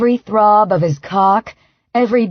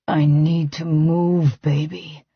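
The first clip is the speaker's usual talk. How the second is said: groaning man; 4 semitones lower, 3 dB quieter, slower than usual. A narrator's reading. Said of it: "he groans".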